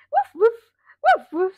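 high, loud playful woofs; dog barking. Shown as a demonstration. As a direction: bark like an excited little dog. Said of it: "What does a dog say?".

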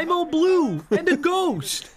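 high, slow cartoon dad impression; Homer Simpson. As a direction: draw it out like a dopey cartoon husband. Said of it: "What if Genie said".

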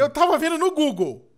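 loud, high, slow protest; indignant shout. Real, not acted. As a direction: protest loudly at being attacked. Protesta contra a traição no jogo. (protesto gritado e agudo)

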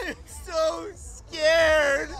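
high, very slow sobbing wail; laugh-crying. Acted. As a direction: sob it out half laughing. Sitcom meltdown parody, repeated.

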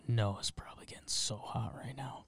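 quick whispered aside. Real, not acted. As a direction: drop to a whisper.

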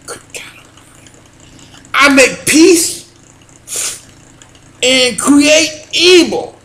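slow, loud, high sermon delivery fading to a hush; preaching at full force. Acted. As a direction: proclaim the verse, then drop to awe. Preaches the Isaiah verse.

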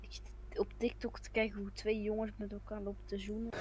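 soft unvoiced confession; whisper. Real, not acted. Gamer whispering a confession to his friends. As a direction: whisper it so nobody else hears.

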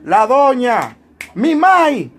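butter up the deaconess sweetly; boy sucking up to the deaconess; fawning kid. Retold or imitated. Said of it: "a las mujeres diaconisas yo le decía".

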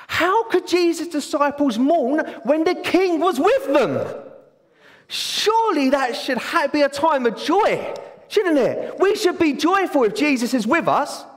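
carried away with joy about Jesus; excited preaching. Real, not acted. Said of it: "Sorry, I'm excited".

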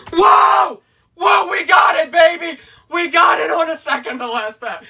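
high, loud thrilled whoa; excited shouting. Real, not acted. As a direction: yell with pure joy at the pull. Big pull revealed.

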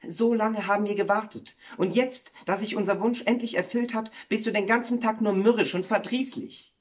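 high, plaintive reproach; dismayed. Acted. Wife reproaches the miller for his gloom, dismayed. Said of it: "sagte die Müllerin betroffen".

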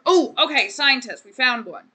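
loud little cheer; excited. Real, not acted. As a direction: pounce on the discovery. Spotting a scientist in game.

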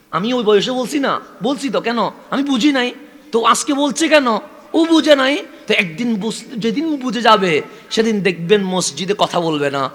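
fervent sermon build; impassioned preaching. Acted. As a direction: lift the congregation with the child's vow. Preacher swells again.

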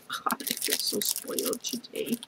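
whispering how spoiled she feels; whispered aside. Real, not acted. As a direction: breathe it out as a private aside. Aside after many gifts.

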